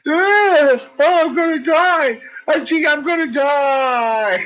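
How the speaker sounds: high, loud wail of doom; mock dying scream. Dog crying like it's being killed, high hammed-up wail. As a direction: ham up a dog dying dramatically. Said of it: "he's screaming".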